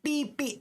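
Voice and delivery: high beeping like a breathalyzer; breathalyzer beep imitation. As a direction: imitate the machine's beeps.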